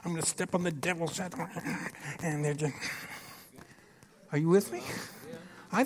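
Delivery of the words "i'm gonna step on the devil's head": lightly mocked declaration prayer; mock fervent prayer. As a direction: imitate the same old prayer lines, quick and mocking. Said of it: "it's the same verses, same things".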